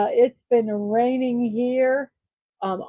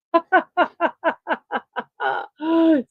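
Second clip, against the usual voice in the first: relieved laugh; 6 semitones higher than usual. Real, not acted. Laugh after finishing word list.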